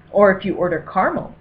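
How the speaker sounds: ordinary delivery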